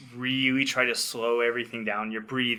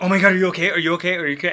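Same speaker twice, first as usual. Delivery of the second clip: higher, much louder panicked questions, settling down toward the end; imitating worried wife; 6 semitones higher, 7 dB louder, much faster than usual. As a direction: play her alarm at the beeping. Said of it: "Amanda was like".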